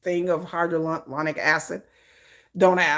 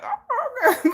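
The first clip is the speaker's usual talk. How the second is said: coy evasion; slower than usual. Retold or imitated. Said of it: "I'm like".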